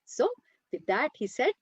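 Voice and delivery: ordinary delivery, nothing marked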